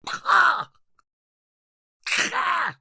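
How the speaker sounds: short, high-pitched yelps; fight cries. Acted. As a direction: cry out sharply in a struggle. Struggle with the intruder.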